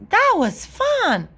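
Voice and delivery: put-on high, drawn-out child glee; delighted little sister. A narrator's reading. Little sister happy after losing race, delighted. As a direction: savour it with delight.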